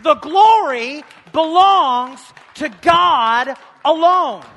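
The glory belongs to God alone, shouted high, loud and slow; ringing preacher's cry; full-voiced shout. Shown as a demonstration. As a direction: proclaim it to the whole church. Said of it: "you can clap for that 'cause it's the truth".

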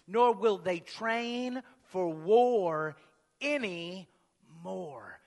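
loud, slow, declaiming preacher; preaching. Acted. Preacher proclaiming the prophecy to his congregation, loud and slow.

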